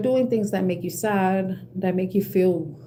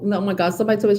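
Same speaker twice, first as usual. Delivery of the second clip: ordinary talk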